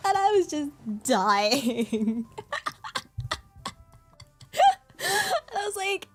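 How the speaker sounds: high, breaking with laughter; laughing hard. Real, not acted. Telling a friend's coffee-shop flop, laughing helplessly. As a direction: laugh through the words.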